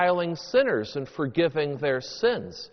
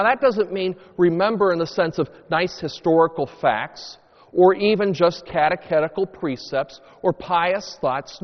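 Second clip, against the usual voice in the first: ordinary talk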